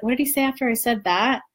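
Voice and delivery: usual speaking voice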